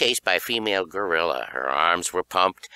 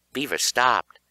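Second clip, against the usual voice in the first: plain reading voice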